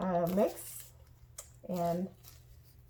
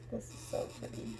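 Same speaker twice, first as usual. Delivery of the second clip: whispered; 10 dB quieter than usual. Retold or imitated. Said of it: "I was just like".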